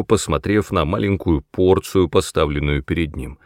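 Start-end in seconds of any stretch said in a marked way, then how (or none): none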